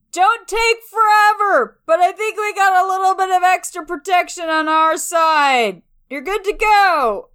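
loud, high call across the distance; yelling after him. Acted. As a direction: shout it after him as he leaves. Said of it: "I yell after him".

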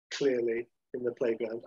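ordinary talk, nothing marked

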